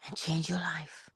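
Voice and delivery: soft unvoiced whisper. Real, not acted. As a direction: whisper the last words for effect. Sermon-like advice to viewers.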